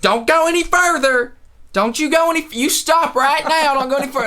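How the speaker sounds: high, agitated retold mom voice; yelling mom. Mom yelling at men walking by the house, high imitation. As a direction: imitate a mom hollering after someone. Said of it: "she would just be like".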